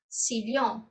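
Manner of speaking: "sillón" with the ll as Portuguese lh; lh sound. Shown as a demonstration. Teacher showing students the lh way. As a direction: say "sillón" as "si-lhon". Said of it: "sonido de ... de L H".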